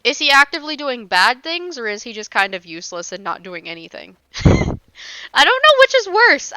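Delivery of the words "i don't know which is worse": laughing. Real, not acted. Laughing at chat question.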